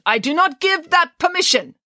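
high, indignant director voice; theatrical, outraged decree; emphatic refusal. Acted. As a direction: forbid it with pompous finality.

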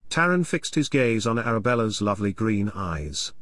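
plain reading voice

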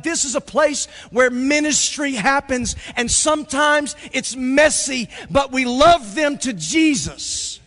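loud, high, building sermon climax; full-force preaching. Real, not acted. Pastor rousing the church about kids, full-force preaching. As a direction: preach it out at the top of your voice.

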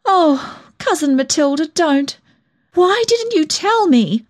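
high, anguished reproach; miserable, almost fierce. A narrator's reading. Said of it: "I said miserably, yet almost fiercely".

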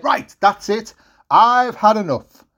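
firm, emphatic telling-off; hacked-off mum. Acted. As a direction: lay down the law as a fed-up mum. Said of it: "getting a bit hacked off".

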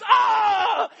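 a high, loud yelp of pain; pained cry. Retold or imitated. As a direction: yelp as your hand smacks the fan. Foot smashes a glass.

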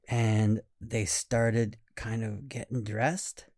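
ordinary delivery, nothing marked

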